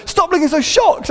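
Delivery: high-pitched mock complaint; imitated scolding wife. Wife scolds him, retold.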